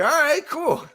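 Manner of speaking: very high, breezy all right; mock-casual shrug. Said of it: "I was like".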